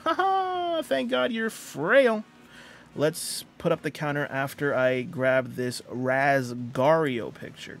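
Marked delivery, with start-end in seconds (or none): 0.0-2.2 high, excited cheer of victory; excited win. Real, not acted. Streamer cheering a Pokemon raid win, high and excited. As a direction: whoop it up, genuinely thrilled. Wins a battle.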